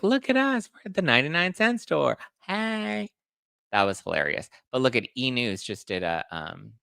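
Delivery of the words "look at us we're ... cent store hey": much higher, squealy fake glee; over-the-top parody of a reality couple; mock squealing celebrity. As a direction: squeal it like a ditzy star showing off. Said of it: "They're like".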